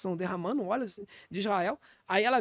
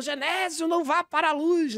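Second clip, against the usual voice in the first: higher, pleading call after her husband; wife calling her dying husband, mock-frantic; mock wife's cry; 7 semitones higher than usual. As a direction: call him back from the light, hammed up. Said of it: "ela já saiu gritando".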